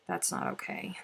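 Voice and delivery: unvoiced, very low hush; whispered mock despair about the chocolate; whispered dismay. Real, not acted.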